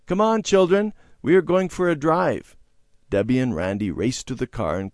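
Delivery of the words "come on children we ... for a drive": high, raised calling voice. A narrator's reading. Father inviting the children for a drive, calling out. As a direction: call the children over cheerily. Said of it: "Just then Father called".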